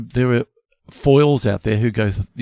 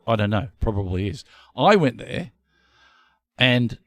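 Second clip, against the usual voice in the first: ordinary talk, nothing marked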